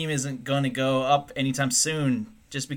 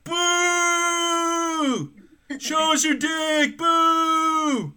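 heckling shout; 4 dB louder, much slower than usual. Pretend. Heckling alien crowd.

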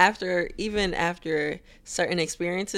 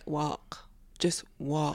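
inner voice; 3 semitones lower, 7 dB quieter, much slower than usual. Pretend. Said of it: "that little voice in your head".

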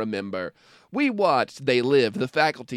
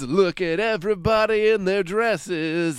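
gushing red carpet chatter; 5 semitones higher, 4 dB louder, slower than usual. Pretend. Mock awards show host intro.

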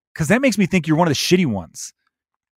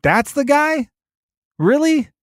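incredulous; 11 semitones higher, slower than usual. Retold or imitated. Incredulous reaction to McConnell's looks.

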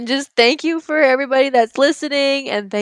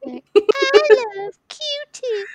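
high, slow cat voice; 13 semitones higher, much slower than usual. Acted. Voicing the cat.

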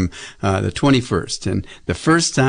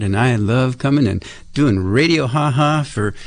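normal talking voice

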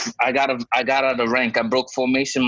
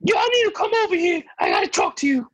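very high, squeaky shouted summons; hammed-up high-pitched angry sergeant; mock shrill yell. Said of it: "I'm just to say".

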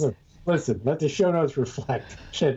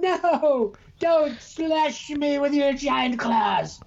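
much higher, slow pleading cry; panicked man; 12 semitones higher, much slower than usual. Pretend. Mocking the voices, a man begging the bear in high panic. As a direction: squeal it like a terrified man. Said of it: "Are you doing voices?".

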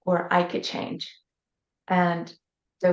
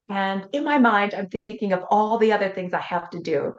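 usual speaking voice

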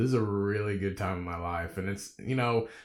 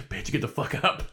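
laughing through a mock scold; laughing self-scold; 4 semitones higher than usual. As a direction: scold yourself while cracking up.

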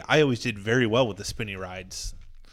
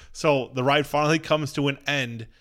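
ordinary talk

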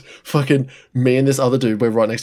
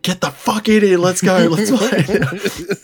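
high-pitched excited yell, breaking into laughter; mock screaming; 7 semitones higher, slower than usual. Squadron screaming at the boss to join handball, mock screaming. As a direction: imitate a crew yelling someone into the game. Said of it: "screaming at him".

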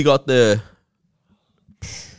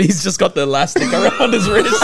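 ordinary delivery

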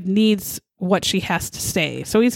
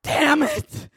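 much higher, hammed-up yelling husband; furious husband rant. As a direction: rant at the wife over apples and jelly, shrieking.

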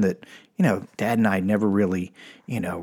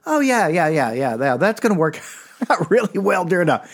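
ordinary talk

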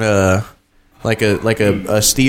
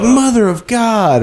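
mock pained exclaim; 11 semitones higher, slower than usual. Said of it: "He's like".

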